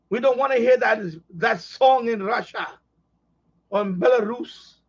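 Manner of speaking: slow, heavy mock Russian accent; bad Russian accent. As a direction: play a stern Russian censor in a bad Russian accent. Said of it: "I have a horrible accent".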